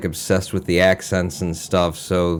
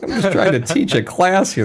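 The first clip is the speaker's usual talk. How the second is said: higher, put-upon professor voice; exasperated professor; 9 semitones higher than usual. Retold or imitated. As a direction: sigh it like a weary lecturer. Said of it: "his internal process must have just been".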